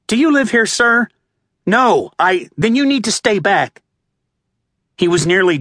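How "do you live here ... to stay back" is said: higher, firm police officer voice; stern cop stopping a father; female cop. A narrator's reading.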